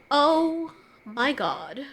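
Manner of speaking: a slow, soft, deadpan "oh my God"; slowed mock reaction from the guard. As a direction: drag it out, unimpressed and flat.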